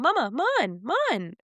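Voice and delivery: put-on high toddler babble; cute mimic of a little boy. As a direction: imitate a toddler calling mama. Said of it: "he'll be walking into a room".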